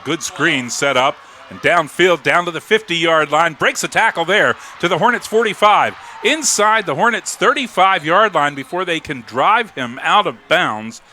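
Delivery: big-gain commentary, voice lifted high; excited play-by-play. Real, not acted. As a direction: call the breakaway run with mounting excitement. Calls a breakaway play live.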